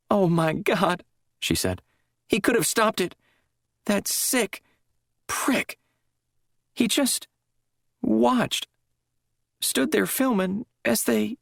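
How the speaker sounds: high, broken and tearful; falling apart over what he watched; distraught. A narrator's reading. Drugged woman telling the chaplain, tearful breakdown.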